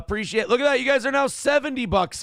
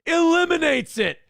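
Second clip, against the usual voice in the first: a triumphant shout, higher and louder; excited shout; 8 semitones higher, 4 dB louder than usual. Real, not acted. Streamer cheering viewers for reaching the goal, loud triumphant shout. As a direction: cheer the goal win loudly.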